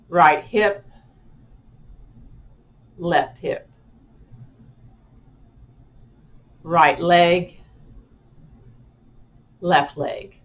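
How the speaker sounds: measured meditation cueing, one part at a time; unhurried relaxation guide leaving space after each phrase; guided body scan. Real, not acted.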